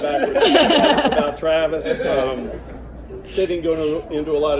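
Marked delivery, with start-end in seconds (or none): 0.0-1.2 laughing, higher, slow correction. Real, not acted. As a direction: stretch it out through laughter.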